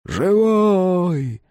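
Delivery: high loud call from far off; distant shout. A narrator's reading. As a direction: cry out from the other end of the site. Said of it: "раздалось где-то далеко".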